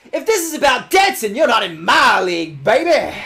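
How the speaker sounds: loud, high boastful shout at a rival; hammed-up bragging yell, sell the swagger; mock taunt. Player taunting the rival trainer, loud mock boast.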